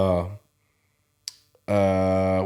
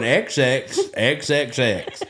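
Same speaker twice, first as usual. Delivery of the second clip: laughing; 4 semitones higher, much slower than usual. Real, not acted. Quoting grandpa while laughing.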